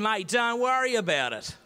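loud, high brush-off; blustering mock confidence, voice pushed up; overdone bravado reassurance. Scared Australian host reassuring his visiting friend, loud bravado.

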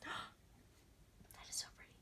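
whispered awe after a sharp gasp; gasp, then whisper it. Real, not acted. Vlogger gasping at her lights, then whispering. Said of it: "I don't know why I'm gasping again".

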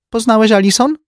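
high, teasing socialite; slyly amused sister; Ursula's voice. A narrator's reading. (wysoki, złośliwie rozbawiony głos)

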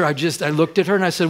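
usual speaking voice

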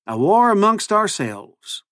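high, drawn-out cowboy drawl; cowboy accent. A narrator's reading. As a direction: play a would-be cowboy, slow and high. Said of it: "his ridiculous new accent".

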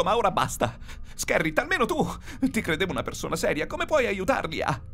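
high, frantic protest; panicked pleading. A narrator's reading. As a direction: plead with crewmates to end the prank, voice way up. Panicked man pleads with unseen crewmates. (proteste acute, in preda al panico)

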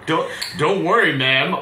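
ordinary talk, nothing marked

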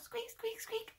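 tiny, very high, soft squeaks; mouse squeak. Shown as a demonstration. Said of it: "Like a mouse".

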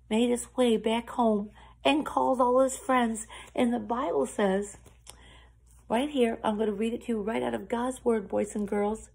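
ordinary delivery

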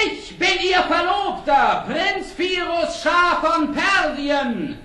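laut und höher gerufen, langsam am Ende; laut rufend. Acted. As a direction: stolz dem Sultan zurufen. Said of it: "mit lauter Stimme zu". (der Prinz ruft vom fliegenden Pferd dem Sultan zu, laut)

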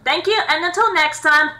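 loud, upbeat sign-off read; YouTube outro. Acted. Host thanking viewers and asking for comments, loud upbeat outro. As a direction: pump up the viewers for the goodbye.

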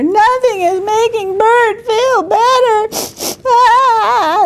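high, hammed-up tearful wail; high theatrical blubbering; mock crying. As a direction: whine it like a sobbing toddler. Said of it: "they all cried and cried".

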